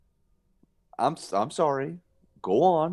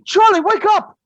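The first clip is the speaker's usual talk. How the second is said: urgent loud call, much higher; shouting to wake him; 13 dB louder than usual. Acted. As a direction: yell his name to wake him. Waking brother from nightmare.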